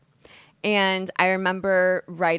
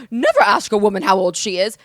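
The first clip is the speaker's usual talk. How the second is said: much louder, put-on outrage; mock indignant outburst; 11 dB louder than usual. Her old self refusing to share her age, mock indignant. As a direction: belt it out in mock offence.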